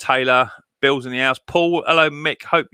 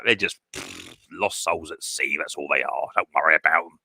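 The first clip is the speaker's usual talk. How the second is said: low, soft and dismissive, almost under the breath; dismissive mutter; 9 semitones lower, 6 dB quieter than usual. Real, not acted. Streamer brushing off chat trolls, in a low dismissive mutter. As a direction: wave the trolls off quietly, not worth the breath.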